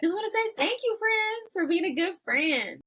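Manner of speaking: very high, sing-song gratitude; high, lilting thank-you to a friend; playful sweet thanks. Real, not acted. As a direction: pitch it way up and stretch it affectionately.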